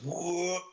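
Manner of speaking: sudden high "whoop" sound effect; imitated whoop. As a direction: make the sound of something heaved up in one go. Imitating the heave of pry bar.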